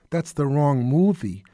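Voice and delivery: ordinary talk, nothing marked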